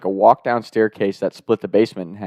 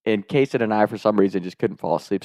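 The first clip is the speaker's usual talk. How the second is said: ordinary delivery, nothing marked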